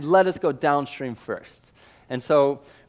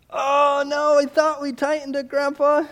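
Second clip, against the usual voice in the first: put-on much higher, guilty little-kid plea; sheepish boy; 10 semitones higher, 3 dB louder than usual. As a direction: whine it out like a caught child. Boys admitting the loose bolt.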